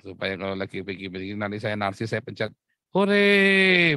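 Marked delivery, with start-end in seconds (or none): 2.9-4.0 loud, high, drawn-out hooray; playful cheer. Shown as a demonstration. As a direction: mimic a canned cheer at the press of a button. (sorakan hore nyaring dibuat-buat)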